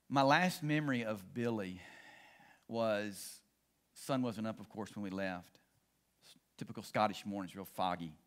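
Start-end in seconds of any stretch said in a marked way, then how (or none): none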